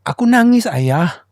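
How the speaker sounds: high, lightly voiced child; a hint of a crying kid; child crying to father. (suara anak yang sedikit merengek)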